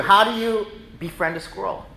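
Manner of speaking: normal talking voice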